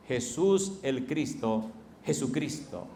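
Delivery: solemn slow title; slow title announcement. Real, not acted. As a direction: say Jesús el Cristo slowly, like a title.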